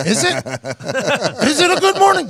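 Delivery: high, rising challenge; hammed-up indignant question; mock incredulity. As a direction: throw it back in high-pitched disbelief. His incredulous comeback to coach.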